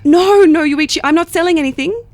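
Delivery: call-centre denial, loud and hurried; insistent denial. Acted. Denying being a telemarketer.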